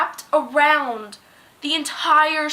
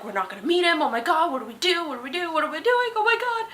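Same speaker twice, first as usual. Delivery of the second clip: imitating panic; 4 semitones higher, 3 dB quieter, faster than usual. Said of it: "We panicked the entire way there".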